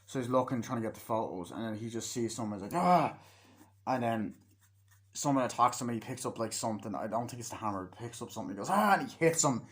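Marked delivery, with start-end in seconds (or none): 2.8-3.2 loud, high mock yelp; short retold cry of alarm; imitated startled cry. Acts out character's startled cry. 8.7-8.9 high, loud mock yell; short retold battle cry; imitated attack yell. Acts out the attack.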